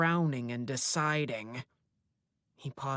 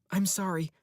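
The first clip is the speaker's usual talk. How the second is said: quick apology; 5 semitones higher, 4 dB louder than usual. A narrator's reading. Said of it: "I say quickly".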